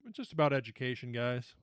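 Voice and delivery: usual speaking voice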